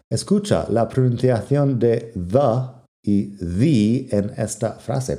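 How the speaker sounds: normal talking voice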